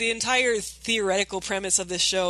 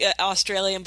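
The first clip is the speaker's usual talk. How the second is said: normal talking voice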